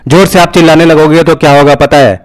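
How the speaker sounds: full-force demo shout, high and overloaded; very loud shout. Shown as a demonstration. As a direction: shout hard enough to clip the mic. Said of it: "मैं बहुत ज़ोर से चिल्लाने वाला हूँ".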